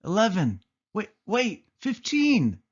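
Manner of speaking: excited counting. A narrator's reading. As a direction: call out each new count in delight. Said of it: "shouted Anita from the other room".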